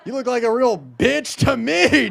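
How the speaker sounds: ordinary delivery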